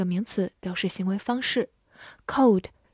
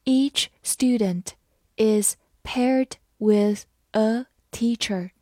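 slow, careful sentence reading; slow classroom read-aloud; slower than usual. Shown as a demonstration. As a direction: read it slowly for learners to follow. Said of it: "好，慢慢来读".

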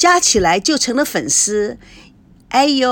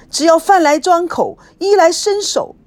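high, loud, tearful complaint; crying; 7 semitones higher, 4 dB louder than usual. Acted. Friend crying over her unfaithful husband, high and tearful. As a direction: sob out the grievance.

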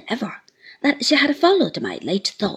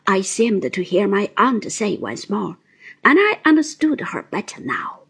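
ordinary delivery, nothing marked

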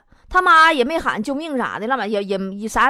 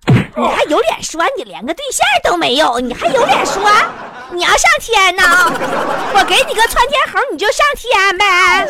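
scolding mom; 6 semitones higher, 5 dB louder than usual. Retold or imitated. Mom slapping and scolding son.

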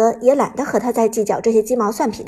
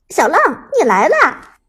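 loud, higher, swooping welcome; affectionate greeting; 5 semitones higher, 4 dB louder than usual. A narrator's reading. Senior player welcoming his friend Xiao Lang, hearty and affectionate. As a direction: greet a buddy with eager familiarity. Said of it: "老K很亲热的打招呼".

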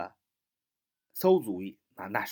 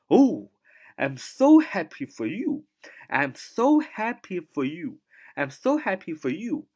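higher "Oh, I'm so happy"; acted delight; 6 semitones higher, 4 dB louder, slower than usual. Shown as a demonstration. Teacher acting out joy at a friend's news. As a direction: sound glad for a friend.

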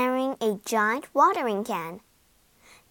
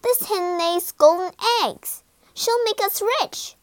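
high, eager insistence; excited boy; 8 semitones higher than usual. A narrator's reading. Jack tells mother of golden eggs, excited insistence. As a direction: argue eagerly, climbing higher.

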